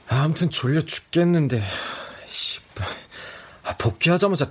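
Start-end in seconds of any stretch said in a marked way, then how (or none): none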